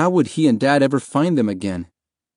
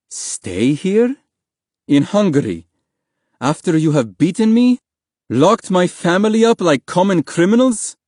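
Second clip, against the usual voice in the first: higher, incredulous questions building louder; indignant anger; 8 semitones higher, 4 dB louder than usual. A narrator's reading. Beaten father answering official about asylum, indignant anger building. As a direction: build from disbelief into outrage. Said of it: "fists clenched and shaking".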